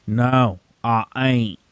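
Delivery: backwoods drawl; hillbilly accent. Retold or imitated. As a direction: rural Southern twang. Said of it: "You're doing a Deep Woods Cletus".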